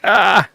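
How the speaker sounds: sharp high yelp of pain; pained scream. Retold or imitated. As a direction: cry out as the belly is pressed. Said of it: "and I scream".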